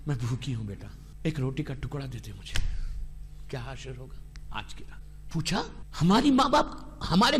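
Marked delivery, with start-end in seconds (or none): 0.1-2.6 put-on low, soft, begging old mother; pleading mother. Dead mother begs her son for a piece of bread, low soft plea. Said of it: "اگر ماں بولے کہ". 3.5-5.3 low, soft grieving question; hushed lament. Acted. Preacher asks the congregation about the night, hushed lament.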